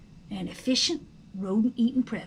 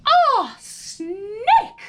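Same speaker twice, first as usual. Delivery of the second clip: sudden high frightened shriek, louder; scream of fright; 8 semitones higher, 7 dB louder than usual. Acted. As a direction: shriek at the sight of a snake. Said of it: "she screamed".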